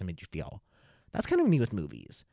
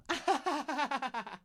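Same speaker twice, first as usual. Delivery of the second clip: high, rapid put-on laugh; imitated kids' cackle; 6 dB quieter than usual. Kids laughing at their prank on teacher, imitated cackle.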